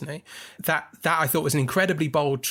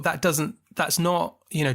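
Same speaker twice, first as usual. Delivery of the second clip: ordinary talk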